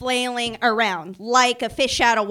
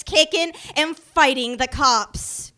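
ordinary delivery

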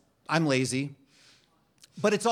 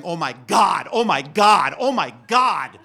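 loud, rising, frantic repeats; frantic oh my God; 3 semitones higher, 9 dB louder than usual. Pretend. Nervous dog pacing the living room, frantic loud panic. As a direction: panic louder and higher each time.

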